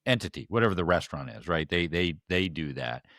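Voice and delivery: normal talking voice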